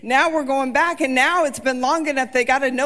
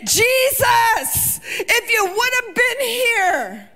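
anguished plea; 8 semitones higher, slower than usual. Acted. Martha's plea voiced in sermon.

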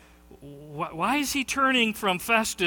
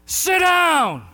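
high, loud barked order; shouted command; 11 semitones higher, 6 dB louder than usual. Retold or imitated. Preacher shouting at the heckler again, loud high order. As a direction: shout him back into his seat. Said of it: "at least ten, twelve times".

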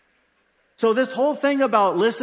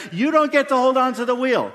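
raised, higher and louder, driving it home; emphatic preaching; 5 semitones higher, 6 dB louder than usual. Real, not acted. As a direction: press the point hard from the pulpit. Preacher insisting on surrender.

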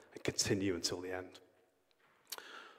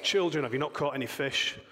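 raised, higher call to the boat from the shore; calling out across the water; 5 semitones higher, 5 dB louder than usual. Retold or imitated. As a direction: shout it over the lake, a touch teasing. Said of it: "somebody shouts over to him".

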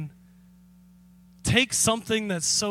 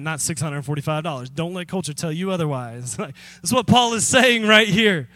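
ordinary delivery

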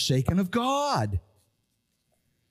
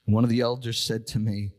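ordinary delivery

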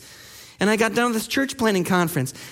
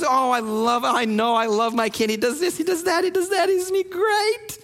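gushing parent; 2 semitones higher than usual. Acted. Parent raving to teachers about their kid, gushing. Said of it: "We won't stop telling the teachers".

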